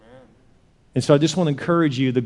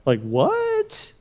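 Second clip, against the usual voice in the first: high baffled squeak; incredulous; 12 semitones higher, 3 dB quieter than usual. Acted. Onlooker hears they must pour water, incredulous. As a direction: play stunned disbelief, pitch shooting up. Acted bystander's disbelief at the order.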